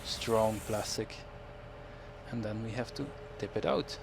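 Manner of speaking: ordinary talk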